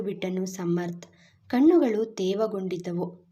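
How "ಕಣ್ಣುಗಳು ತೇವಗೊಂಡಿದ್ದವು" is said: ordinary delivery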